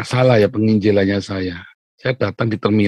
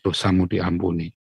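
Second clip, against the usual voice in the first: softer, lower "Dosamu diampuni" said kindly; gentle absolution; 4 semitones lower, 7 dB quieter than usual. Retold or imitated. Pastor softly forgiving a congregant who keeps falling, tender. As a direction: murmur "Dosamu diampuni" gently, like comforting a sinner. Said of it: "datang ngomong".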